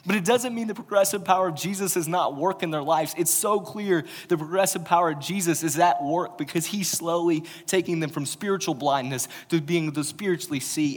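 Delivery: loud, driving sermon delivery; preacher driving the point home, loud; full-force preaching. Acted. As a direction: preach it to the back row.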